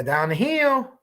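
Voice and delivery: a hint of Ron Logan, slowed right down; slow mock Ron Logan. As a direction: drag out "down the hill" lazily. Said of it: "That's how he says it".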